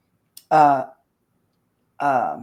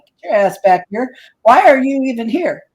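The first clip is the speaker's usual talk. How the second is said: higher, louder telling-off of rookies; exasperated scolding; 6 semitones higher, 5 dB louder than usual. Retold or imitated. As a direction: scold them like a fed-up team leader. Acted scolding of younger investigators.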